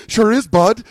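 loud, high Canadian bud talk; exaggerated Canadian tough-guy accent; mock Canadian accent. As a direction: throw their Canadian accent back at them. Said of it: "he's talking like us now".

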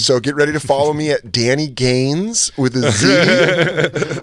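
slow plug through laughter; hammed-up influencer pitch; laughing mock plug. Real, not acted. Promoting a fake fitness page, laughing.

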